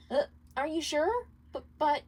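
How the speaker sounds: high, halting, unsure voice; hesitant. Acted. Charlie doubting Alistair can handle it, high and hesitant. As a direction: trip over the words, not wanting to agree. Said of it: "clearly hesitant".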